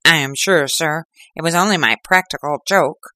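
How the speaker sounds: a touch of a whimpering excuse; frightened culprit excusing himself to the inspector. Acted. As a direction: plead it away, cowering. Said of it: "began to whimper".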